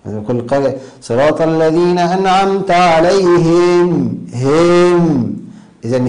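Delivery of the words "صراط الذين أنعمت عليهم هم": higher chant, عليهم ending in a long "hiiim"; over-lengthened hāʾ vowel. Shown as a demonstration. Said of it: "إشباع الهاء".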